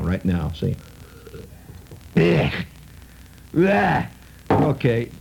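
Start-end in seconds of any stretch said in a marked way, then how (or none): 2.2-2.5 guttural, throaty "ugh"; mock revolted "ugh" after a sip; demonstrated disgust reaction. Shown as a demonstration. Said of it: "I'll show you now". 3.6-4.1 high, drawn-out "ugh" of revulsion; mock-retching cry of disgust; demonstrated disgust reaction. Shown as a demonstration. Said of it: "that's the way it's properly used".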